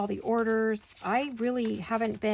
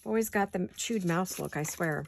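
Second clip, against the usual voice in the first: usual speaking voice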